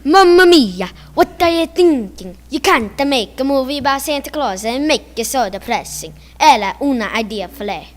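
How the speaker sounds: loud, hammed-up Italian accent. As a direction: belt it out like an exaggerated Italian. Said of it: "can you demonstrate that".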